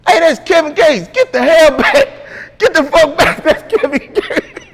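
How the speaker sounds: a fan freaking out, half laughing; excited yell through laughs. Retold or imitated. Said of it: "That boy see me and said".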